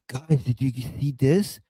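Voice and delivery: ordinary talk, nothing marked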